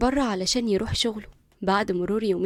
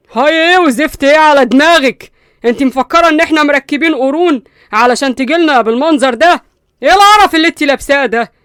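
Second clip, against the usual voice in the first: much louder, higher angry yell; furious shouting; 5 semitones higher, 14 dB louder than usual. Acted. Qusay yelling at his cousin over her clothes, furious. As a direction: explode at her outfit, disgusted. Qusay scolding Linda's outfit.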